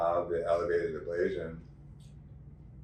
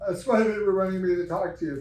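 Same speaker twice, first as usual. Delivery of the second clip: high, louder, eager explaining; animated retelling; 12 semitones higher, 5 dB louder than usual. Retold or imitated. Patient explains to the doctor, high and eager. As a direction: rush to explain, pitched up. Speaker retelling her own answer.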